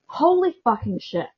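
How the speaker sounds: loud, slow, stunned swear; shocked outburst. Real, not acted. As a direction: blurt it out in real shock. Reacting to the shocking story.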